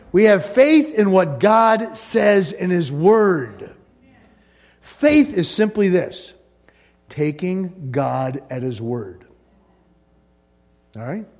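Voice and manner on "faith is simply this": usual speaking voice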